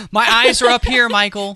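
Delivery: high, loud mock outrage; mock offended. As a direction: play it up as scandalized. Joking mock offense over Judy Greer.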